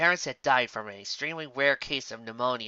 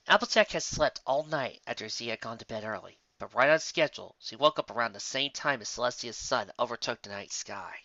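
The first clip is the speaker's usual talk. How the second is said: plain reading voice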